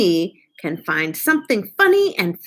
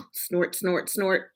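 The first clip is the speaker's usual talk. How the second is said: soft, low snort sounds; 3 semitones lower, 9 dB quieter, slower than usual. Acted. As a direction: snort it quietly like an ogre. Imitating ogre snorting.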